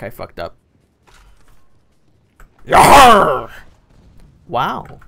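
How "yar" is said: loud mock pirate "Yar"; pirate yell. Gamer tossing out a pirate cry. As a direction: shout it like a hammy buccaneer. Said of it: "I'm sounding like a fucking pirate".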